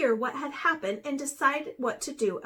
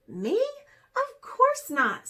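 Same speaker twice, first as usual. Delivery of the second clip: high, slow, louder denial; indignant denial; 10 semitones higher, 4 dB louder than usual. A narrator's reading. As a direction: protest your innocence. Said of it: "said Raven".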